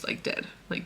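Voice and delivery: hushed whisper on dead. Real, not acted. Telling viewers the heroine dies, whispering. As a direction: drop to a whisper. Sad fic ending retold.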